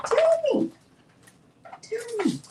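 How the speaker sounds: very high-pitched coaxing of a pet; high little baby-talk plea. Pretend. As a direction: squeak it playfully, as if to a pet.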